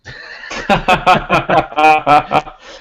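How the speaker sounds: loud, high burst of laughter; real, pealing cackle of amusement. Real, not acted. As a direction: laugh out hard in a long run. Player laughs at not knowing DC.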